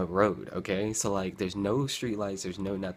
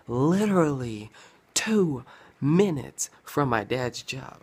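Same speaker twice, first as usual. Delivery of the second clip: slow, higher and louder; dramatic emphasis; 5 semitones higher, 5 dB louder, slower than usual. Real, not acted. Telling his viewers, slow emphatic stress. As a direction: stretch it out for effect.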